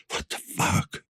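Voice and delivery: breathless whisper of shock; stunned whispered swear; whispered curse. Acted. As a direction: hiss it under your breath. Detective alone, shocked.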